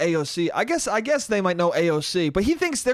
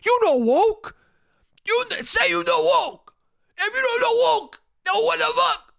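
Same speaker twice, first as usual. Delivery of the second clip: hammed-up Italian shouting. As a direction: shout until they get it. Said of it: "Let me just shout at you until you understand".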